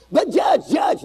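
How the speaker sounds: whiny appeal, pitch shooting high on judge; mock pleading. Defendant whining to the judge, mock plea.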